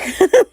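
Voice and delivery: high laugh bursting out; sudden high giggle; burst of high laughter. Real, not acted. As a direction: laugh at the mess. Jute falls off wire.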